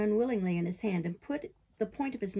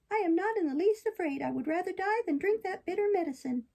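high-pitched puppet defiance; defiant puppet; 10 semitones higher than usual. Acted. Puppet answering the fairy's warning, defiant.

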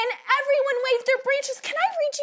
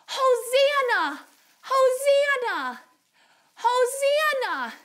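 slow, higher, drawn-out cheer; say-along demo; 4 semitones higher, slower than usual. Shown as a demonstration. Teacher asks children to say it along, slow cheerful chant. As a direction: stretch the word out for others to join. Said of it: "Can you say that with me".

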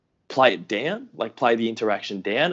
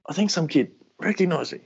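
stunned disbelief; 7 semitones higher than usual. Retold or imitated. Telling mate Jordo at the sink.

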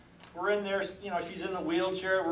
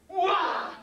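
loud high acted roar; explosive mock war cry; heaving battle yell; 10 semitones higher, 5 dB louder than usual. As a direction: yell as you heave a boulder. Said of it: "and I go".

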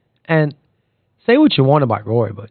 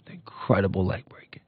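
a sudden hush, much softer and breathy; hushed dramatic; 6 semitones lower, 12 dB quieter than usual. Real, not acted. Podcaster recalling a leg break in a dramatic hush. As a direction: drop to a whisper for the grim injury. Recalling Paul George's injury.

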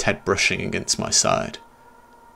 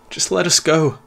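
high, louder desperate plea; pleading; 8 semitones higher, 4 dB louder than usual. Acted. Narrator begging the boy to free them, desperate pleading. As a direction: beg for release. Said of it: "I pleaded".

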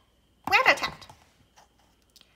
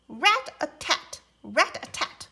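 pecking rhythm; 4 semitones higher, 5 dB louder than usual. Acted. Woodpecker sound.

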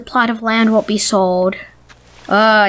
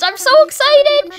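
squeaky high put-on villager voice; 12 semitones higher than usual. Player voicing the villager's reply, squeaky high. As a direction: play the giddy villager. Reading villager's text aloud.

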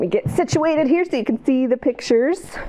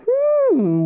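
plain reading voice

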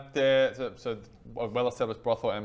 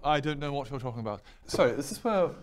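put-on posh denial; nervous posh; 3 semitones higher, 4 dB louder than usual. Son denies the accent to friends, nervously posh. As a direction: carry on stubbornly in a posh accent. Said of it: "What accent is that?".

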